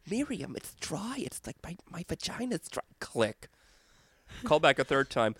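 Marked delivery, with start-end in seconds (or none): 0.1-2.8 high hushed prank voice. Retold or imitated. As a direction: whine it breathily down the phone. Prank call to the aunt.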